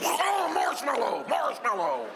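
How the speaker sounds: high, drawn-out cry, falling at the end; wailed safe word. Retold or imitated. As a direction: wail desperately for rescue. Wailing safe word under the bear.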